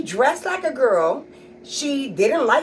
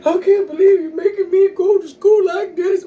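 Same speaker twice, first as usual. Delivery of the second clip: higher, louder kid complaint, lightly voiced; lightly voiced son protesting the injustice; complaining kid; 8 semitones higher, 7 dB louder than usual. Son complaining to his mother about going to school, higher and louder. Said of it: "he was like".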